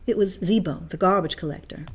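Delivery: ordinary delivery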